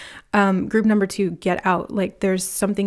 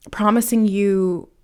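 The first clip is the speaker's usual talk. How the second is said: ordinary delivery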